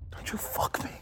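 urgent whisper at the dice; whispered plea. Real, not acted. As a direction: hiss it under breath.

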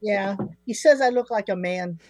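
ordinary talk

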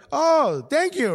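high-pitched mimicked thanks; imitated grateful reply. Footballer thanking a well-wisher, high imitated voice. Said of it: "He was like".

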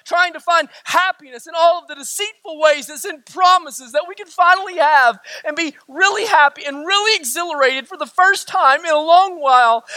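ordinary talk

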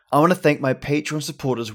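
ordinary delivery

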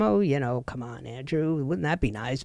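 low, softened inner thought; dismissive aside. Retold or imitated. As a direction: retell it as a quiet, doubtful thought. Recalling her own sceptical thought.

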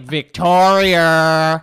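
very slow, loud "Victoria" drawn out; loud mock teacher "Victoria"; mocking teacher's Victoria. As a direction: stretch out "Victoria" like a troll. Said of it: "you're doing that to just be a troll".

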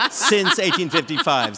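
laughing through the words. Real, not acted. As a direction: chuckle the date out. Repeats date through laughter.

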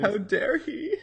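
high, hammed-up indignation; mock outrage. Cohost joining the joke against himself, high mock outrage. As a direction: gasp it out in fake offence.

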